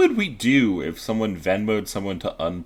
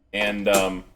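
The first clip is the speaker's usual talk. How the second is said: ordinary talk, nothing marked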